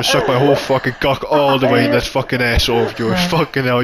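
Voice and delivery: ordinary talk, nothing marked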